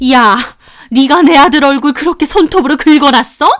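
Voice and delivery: sharp, high, loud accusing mother. Retold or imitated. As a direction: confront her like an outraged mother. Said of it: "다짜고짜 야라고 하면서 따지시는 겁니다".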